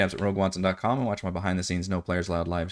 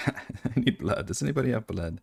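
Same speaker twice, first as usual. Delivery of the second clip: thirsty vampire voice; 6 dB quieter than usual. Acted.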